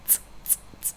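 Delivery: ts as at the end of it's; a quick unvoiced ts; Russian ts sound demo. Shown as a demonstration. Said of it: "like you say it in the word it's".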